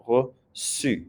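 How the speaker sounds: "reçu" in two parts, the C as a clear S; S sound. Shown as a demonstration. Teacher showing students the accent's effect, "reçu" with an S sound. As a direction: split "reçu" after the first syllable, then a hissed S. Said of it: "It'll be the S sound again".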